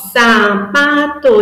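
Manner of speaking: slow syllable-by-syllable sapato; syllables split apart. Shown as a demonstration. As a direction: set out sapato before removing a syllable. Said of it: "Eu tenho três cartões".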